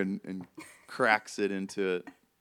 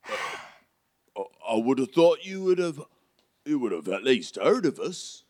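higher, incredulous character voice; slightly affronted storybook helper; Hagrid; 5 semitones higher, 3 dB louder than usual. Acted. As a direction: explain with surprise in a put-on accent.

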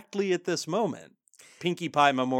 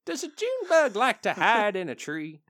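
higher-pitched country granny; folksy grandma; 7 semitones higher, 4 dB louder than usual. Retold or imitated. Granny pony's folksy one-liner, quizzical grandma voice. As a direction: put on an old granny's quizzical lilt. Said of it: "sounds like something that your grandma would say".

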